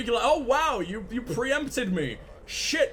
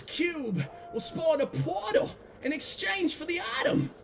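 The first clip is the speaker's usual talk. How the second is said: mock Southern accent; 5 semitones higher than usual. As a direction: ham up a Southern accent reading chat. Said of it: "in an approximation of your accent".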